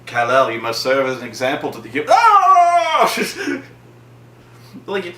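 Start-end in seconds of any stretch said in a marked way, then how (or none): 0.1-2.0 quick, low, stately lecture; a hint of a grave father figure; lightly voiced solemn hologram. Hologram father lecturing his son, solemn role. 2.1-3.1 long, high, loud mock scream; startled scream. Superman surprised while taking a whiz, startled scream. Superman caught off guard mid-speech.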